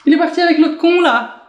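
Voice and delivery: a jilted friend grumbling; sulking best friend. Pretend. The abandoned best friend grumbling about the other, sulky. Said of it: "en mode".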